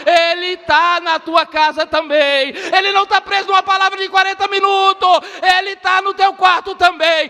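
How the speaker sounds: high, fervent pulpit shout; full-force preaching. Real, not acted.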